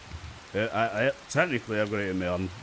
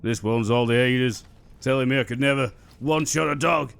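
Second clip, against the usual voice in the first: ordinary talk, nothing marked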